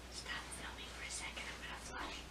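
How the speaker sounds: hushed, breathy whisper. Real, not acted. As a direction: whisper it so no one hears. Private aside off camera.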